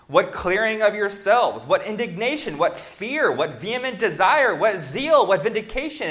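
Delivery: high, loud, rising scripture litany; fervent pulpit reading; preaching build. Acted. Emphatic scripture reading, rising intensity.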